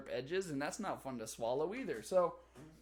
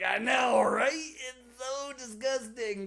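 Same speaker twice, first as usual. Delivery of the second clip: excited agreement; 8 semitones higher, 7 dB louder, slower than usual. Retold or imitated. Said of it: "I was like".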